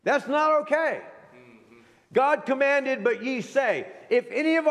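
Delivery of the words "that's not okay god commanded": normal talking voice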